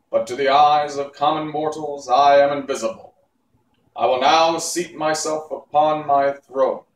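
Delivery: loud, grand voice; booming Oz. Acted. Oz answers Dorothy, loud and grand. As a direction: boom it like a god.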